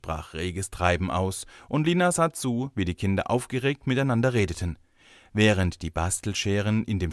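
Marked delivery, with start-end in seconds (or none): none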